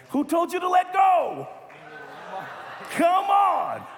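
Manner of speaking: loud, high mock outrage at the kids; playful preacher yelling at the youth; mock scolding shout. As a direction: shout at them in fake indignation.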